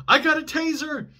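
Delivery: high, loud mock cop shout; imitating a cop yelling. Cop warning the hiding suspect, high loud shout.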